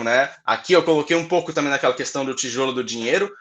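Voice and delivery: usual speaking voice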